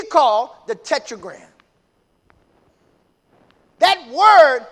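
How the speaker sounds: emphatic pulpit teaching; fervent preaching. Real, not acted. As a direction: drive the point home to a congregation. Preaching at full force.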